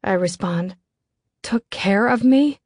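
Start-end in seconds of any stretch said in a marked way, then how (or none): 1.4-2.6 higher, drawn-out indignant question; slow, rising incredulity; incredulous retort. A narrator's reading. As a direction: throw the claim back in disbelief. Incredulous retort to caller's claim.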